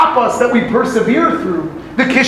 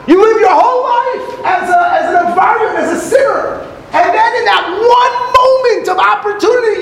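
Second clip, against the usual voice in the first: cheering congratulations; 11 semitones higher than usual. Retold or imitated. Rebbe congratulating the sinner, cheering. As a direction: pump him up like a coach. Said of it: "Rebbe was saying".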